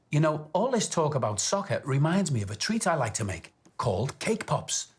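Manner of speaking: usual speaking voice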